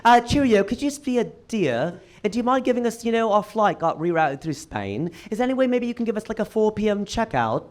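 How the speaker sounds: put-on a light posh British accent, polite and pleading; British accent impression. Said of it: "spot on British accent".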